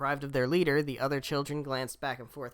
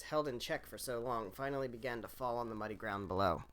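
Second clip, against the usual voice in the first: ordinary delivery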